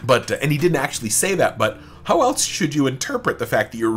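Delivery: ordinary talk, nothing marked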